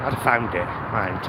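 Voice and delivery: normal talking voice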